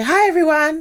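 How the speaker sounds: high, loud bright hello; cheery greeting. Shown as a demonstration. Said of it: "to say".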